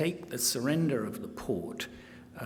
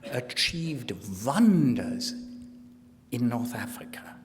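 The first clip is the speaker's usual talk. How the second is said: emphatic mock German accent, stretching key words; German-accented diary declamation; 3 semitones higher than usual. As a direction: boast in a put-on German accent. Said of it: "speaking with a mock German accent".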